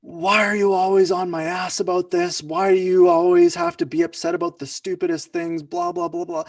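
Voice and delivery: mock furious rant at partner over garbage; dominating angry outburst. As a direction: shout them down, overbearing and fed up. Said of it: "we raise our voice".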